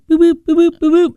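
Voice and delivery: mock robot boops. Radio host mocks a caretaker working a puppet Richard, high robotic boops. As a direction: make puppet control beeps, high and bright. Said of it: "she just went".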